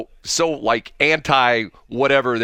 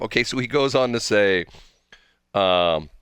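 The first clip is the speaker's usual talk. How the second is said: usual speaking voice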